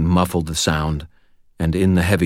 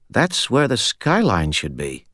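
slow, shaky higher voice; unsteady voice; 5 semitones higher than usual. Acted. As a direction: point it out, still shaken. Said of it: "His voice still unsteady".

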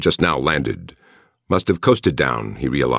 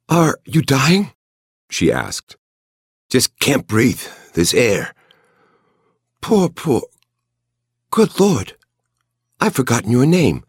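high, breathy woman's voice; breathless young woman; 9 semitones higher than usual. A narrator's reading. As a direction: gasp it out, caught up in the moment. Anne voiced, breathless love scene.

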